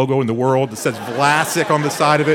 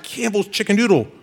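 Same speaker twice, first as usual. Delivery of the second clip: put-on excited kid; 9 semitones higher than usual. Brother unwrapping Christmas present.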